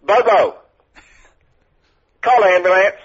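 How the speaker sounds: put-on Southern accent. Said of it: "You need to work on your accent".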